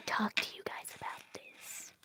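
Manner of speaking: conspiratorial whisper. Real, not acted. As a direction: whisper it like a secret. Secret from parents.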